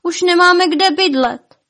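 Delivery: high-pitched, loud wailing; tearful lament. A narrator's reading. Despairing elves with nowhere to live, wailing.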